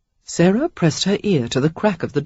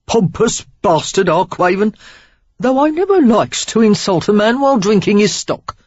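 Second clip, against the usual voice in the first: loud, high Cockney grumble; cheeky Cockney rogue; Cockney accent; 5 semitones higher, 5 dB louder than usual. A narrator's reading. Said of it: "Cockney in his accent".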